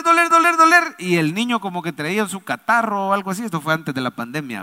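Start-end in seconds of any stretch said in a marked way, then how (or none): 0.0-1.0 mock mounting ache, high and insistent; mounting pain. Preacher acting out a sudden earache on the plane, mounting pain. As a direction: pitch jumps up on each hammered repeat.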